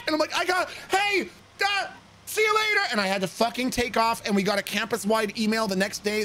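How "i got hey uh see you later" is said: high, flustered goodbye; panicked exit. Acted. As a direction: stammer an excuse and bolt.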